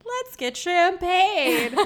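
giddy, laughing through the words. Retold or imitated. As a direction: squeal it out with a laugh. Retold tipsy champagne suggestion.

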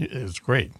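usual speaking voice

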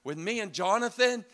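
fervent prayer. Retold or imitated. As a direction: beg God for a breakthrough. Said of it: "And I'm praying".

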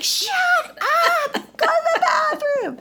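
high laughing shout; inner scream. Retold or imitated. Mom's inner voice yelling at son, laughing shout. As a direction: yell it with a laugh, venting. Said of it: "Inside, I'm like".

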